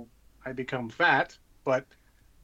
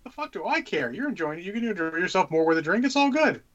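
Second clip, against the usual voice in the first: much higher, easygoing yeah dude; breezy high reassurance; 6 semitones higher than usual. Retold or imitated. Retelling reassuring his tournament opponent, high and breezy. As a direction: wave it off cheerfully. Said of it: "I'm like".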